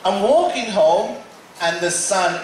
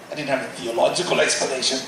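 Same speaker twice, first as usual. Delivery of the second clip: ordinary talk, nothing marked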